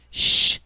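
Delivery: a drawn-out "shh"; sharp shush. Acted. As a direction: shush a child while on the phone. Mother shushes boy during phone call.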